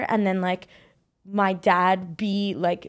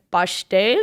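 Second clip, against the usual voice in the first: put-on Spanish accent; 3 semitones lower than usual. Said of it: "he's just in Spanish".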